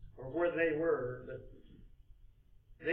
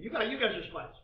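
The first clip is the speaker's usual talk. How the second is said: suspicious accusation; 6 semitones higher, 4 dB louder than usual. Acted. Joseph accusing his brothers of spying, suspicious. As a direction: pitch lifted in acted suspicion. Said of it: "He lets them feel his suspicion".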